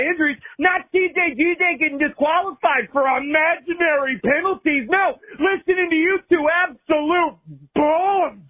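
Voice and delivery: anguished, choked-up fan venting at the booth; tearful rant. Real, not acted. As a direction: voice cracking high and near tears while ranting. Caller's tearful rant at commentators.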